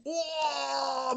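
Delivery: long, high amazed cry. Real, not acted. Card opener spotting a valuable card, amazed cry. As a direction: let out a drawn-out, high "oh" of delight.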